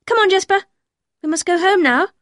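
high, loud call to a dog. Acted. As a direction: summon the dog to come along. Calling the runaway dog.

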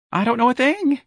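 very high, piping little voice. A narrator's reading. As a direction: squeak out a nervous denial. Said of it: "Mister Gonzalez piped".

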